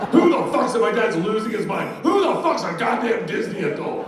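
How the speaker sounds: high, loud outraged rant; imitated dad yelling. Dad rants about brother-in-law being a Disney adult, mock outraged yell. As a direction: blow up like a dad losing it. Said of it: "Dad, don't yell".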